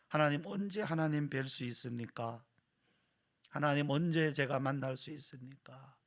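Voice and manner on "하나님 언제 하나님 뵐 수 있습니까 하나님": ordinary delivery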